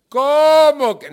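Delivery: loud, high, drawn-out cry of disbelief; indignant yell with the first word stretched out; indignant outburst. Acted. Someone who was just told no, indignant shout. Acted indignant reply to being refused.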